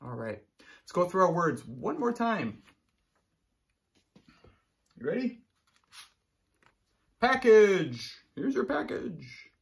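usual speaking voice

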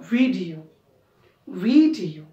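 Video with the first syllable punched; video stressed at the start, falling after. Shown as a demonstration. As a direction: hit VI in video. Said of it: "पहिल्याला stress".

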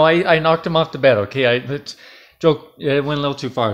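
ordinary delivery

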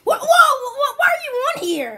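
high, loud startled outburst; alarmed. Acted. Friend catching the other on a website, alarmed. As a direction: stammer out the shocked question.

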